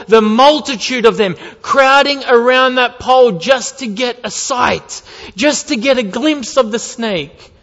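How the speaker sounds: loud, high preaching on urgency; impassioned preaching. Acted. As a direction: stir them with the terror. Preaching at full force.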